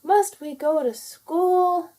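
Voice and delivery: high, slow whiny complaint; complaining sisters. A narrator's reading. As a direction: whine it like bored little rabbits. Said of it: "they complained loudly".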